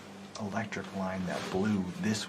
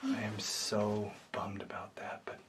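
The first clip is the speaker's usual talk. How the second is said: bummed, hushed whisper; 5 dB quieter than usual. Real, not acted. Hunter whispering his regret to the camera. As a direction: whisper the disappointment quietly.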